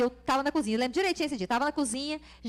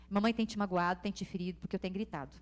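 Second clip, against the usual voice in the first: soft, quiet apology to her son; tender confession; 10 semitones lower, 6 dB quieter, faster than usual. Retold or imitated. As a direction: gently admit the fault to the child. Mãe confessa ao filho. (confissão terna e baixinha de mãe ao filho)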